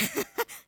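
quick high laugh; bright short chuckle; high burst of laughter. Real, not acted. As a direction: laugh at the absurdity. Laughing at own point.

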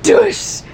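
high, sudden whooshing sound effect; imitated whoosh. Friend imitating Edward's rescue, high sudden whoosh. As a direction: burst out a high-pitched swoosh.

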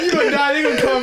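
bursting out laughing mid-sentence; high-pitched laughing through the words. Real, not acted. Joking about dying dad.

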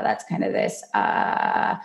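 low creaky fry, almost unvoiced; vocal fry. Shown as a demonstration. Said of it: "So there's vocal fry".

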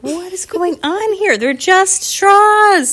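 high, drawn-out disbelief; hammed-up baffled outcry, last words loud and stretched; exasperated parents. Parents face fighting kids, in loud exaggerated disbelief.

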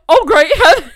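laughing exclamation. Real, not acted. Laughing reaction.

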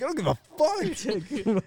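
high, drawn-out exasperation; retold pissed-off outburst. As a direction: stretch it out in mock fury. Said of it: "I was just pissed".

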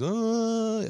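"Ah" high and loud, then "yes" drawn out; mock the teacher's pompous "Ah, yes"; lofty teacher catchphrase. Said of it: "It was always".

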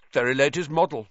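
mildly careless bragging; careless boast. A narrator's reading. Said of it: "said Flint carelessly".

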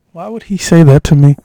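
loud, affronted protest; indignant outburst. Real, not acted. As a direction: burst out in mock-wounded outrage. Outraged at friend's relayed insult.

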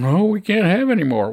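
high, whiny mock protest; a sarcastic jab at sanctuary cities; mocking quote. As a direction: mimic the cities' complaint.

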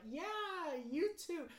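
high-pitched mock-friendly greeting; faked friendliness. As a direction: pretend you know them, voice way up high. Faking recognition of a stranger.

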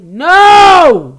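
huge, high, drawn-out scream; screamed refusal. Retold or imitated. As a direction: explode into a long outraged no. Screamed refusal to his request.

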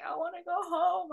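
put-on child gazing at stars, wanting home; childish plea. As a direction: whine like a homesick kid. Child longing to leave Earth.

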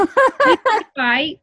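slow, high, loud protest; emphatic denial. Real, not acted. To her friend, insisting her family doesn't fight, loud and slow. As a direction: drag it out in loud mock outrage.

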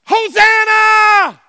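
high, drawn-out cry of praise; exultant shout. Retold or imitated. Preacher cries the crowd's praise to congregation, exultant shout. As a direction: shout it out long and high to the heavens. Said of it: "They were shouting".

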